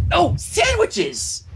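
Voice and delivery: very high, slow, drawn-out exclaim; high slow excited cry. Acted. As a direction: gush over the sandwiches.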